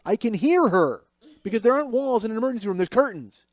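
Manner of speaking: loud, high-pitched disbelief; incredulous outburst. Real, not acted. Patient overhearing nurses through emergency room curtains, incredulous outburst. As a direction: raise pitch and volume in comic outrage.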